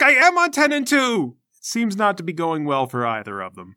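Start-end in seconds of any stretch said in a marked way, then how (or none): 0.0-1.4 high, loud exasperated retort; frustrated student's loud protest; snapping back. Acted. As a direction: snap back at the trainer, pitched way up. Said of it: "she snaps at him".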